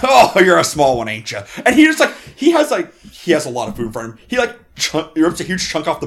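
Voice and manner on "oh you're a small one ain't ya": loud jovial dwarf ribbing the little one; hearty dwarf teasing. Acted. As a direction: boom it out like a big-hearted dwarf. Dwarf teasing the small elf.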